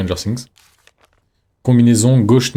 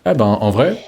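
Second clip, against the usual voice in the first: usual speaking voice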